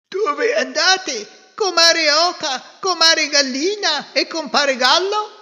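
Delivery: high, loud quacky duck; squawking little duck voice. Acted. Duck character speaks.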